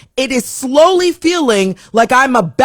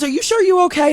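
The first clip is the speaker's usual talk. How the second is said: concerned coworker; 9 semitones higher, faster than usual. Retold or imitated.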